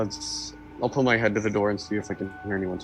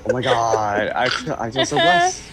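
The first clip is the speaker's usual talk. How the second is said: mock overwhelmed gratitude; 3 semitones higher, 6 dB louder than usual. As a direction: gush it, hammed-up and thrilled. Mock reply to a blessing.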